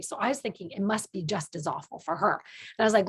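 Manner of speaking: normal talking voice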